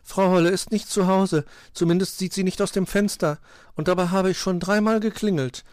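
high, loud little bird voice; disappointed little bird. A narrator's reading. Zwitschi reports nobody answering the bell, disappointed. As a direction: chirp it high with a touch of disappointment. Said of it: "war Zwitschi enttäuscht".

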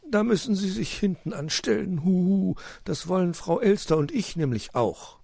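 high, hooting owl voice; owl uncle. A narrator's reading. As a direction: scold lightly with a hooted huhu.

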